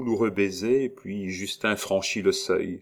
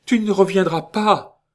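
terrified cry; 12 semitones higher, 9 dB louder than usual. A narrator's reading. Terrified mother continues crying out.

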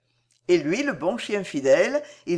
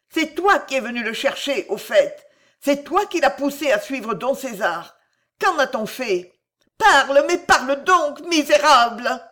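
higher and louder, building to a shout; hard furious voice; 7 semitones higher, 5 dB louder than usual. A narrator's reading. Girl shaking the boy for the truth, building to a furious shout. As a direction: demand an answer, furious. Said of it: "d'une voix dure qu'il ne lui connaissait pas".

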